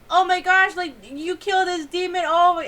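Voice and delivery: high, loud excited mock Zenitsu gushing. Zenitsu gushing over the little boy, high and loud. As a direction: gush like a clueless hero.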